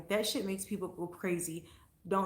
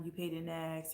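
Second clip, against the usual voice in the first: usual speaking voice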